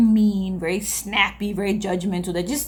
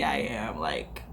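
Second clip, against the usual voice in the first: whispered; 10 dB quieter, slower than usual. Retold or imitated. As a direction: drop to a whisper at the end. Self-scolding trails into whisper.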